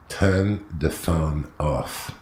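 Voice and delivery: slow, deliberate order in a mock German accent; drawn-out German-accented command; German passenger. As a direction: imitate a stern German, one word at a time. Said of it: "A German guy".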